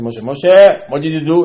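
loud reproach calling Moshe twice; rebuking call. Retold or imitated. Hashem calling Moshe to account, loud reproach. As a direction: call him out sharply.

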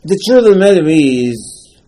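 loud, slowed The truth of the matter is; emphatic declaration. Acted. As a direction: announce the key truth forcefully. Preacher raises key point.

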